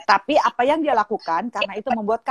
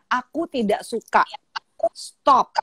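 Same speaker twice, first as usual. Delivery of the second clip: firm; much slower than usual. Retold or imitated. Mother models refusal for daughter to copy, slow firm tone. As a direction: set the boundary in your own steady voice. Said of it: "dengan tegas dan dengan suara yang senada".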